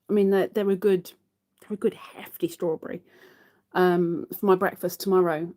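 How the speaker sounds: ordinary talk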